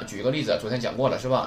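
usual speaking voice

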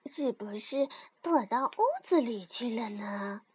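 soft, low inner wondering; thinking aloud. A narrator's reading. 蹦蹦 wonders to himself, soft and low. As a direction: muse quietly to yourself. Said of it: "蹦蹦心里想着".

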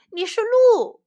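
high, loud shout; contradicting shout. A narrator's reading. Deer contradicting the moose, shouting. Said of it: "鹿喊道".

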